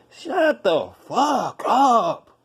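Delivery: high, slow, drawn-out shut the fuck up; loud slow disbelieving outburst; exasperated yell. Real, not acted. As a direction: cut him off, fed up. Mocking the hard-work advice.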